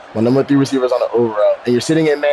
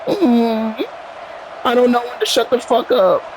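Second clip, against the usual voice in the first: mocking opponent voice; 9 semitones higher, slower than usual. Mocking the opponent's own words.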